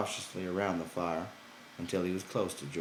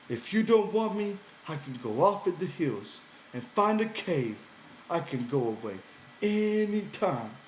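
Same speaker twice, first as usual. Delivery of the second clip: Lenny pouting, higher and louder; high, sulky simple man; 10 semitones higher, 5 dB louder than usual. Acted. Lenny threatening to go live in a cave, high and sulky. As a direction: push it up high with a hurt edge.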